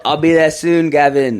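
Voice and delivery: loud imitation of an Aussie; Australian accent. Retelling a video call with an Australian, mock Aussie accent. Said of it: "he's from Australia".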